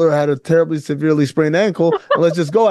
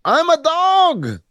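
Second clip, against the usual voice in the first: confident player quote; 9 semitones higher than usual. Retold or imitated. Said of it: "He said".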